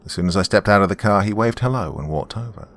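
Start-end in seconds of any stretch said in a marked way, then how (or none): none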